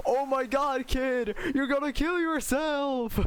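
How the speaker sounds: high-pitched mock-horrified chef; Gordon Ramsay. Chef to a kid cooking badly, mock alarm. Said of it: "Gordon Ramsay is like".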